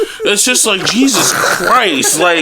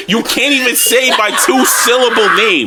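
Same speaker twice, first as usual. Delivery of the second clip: ordinary talk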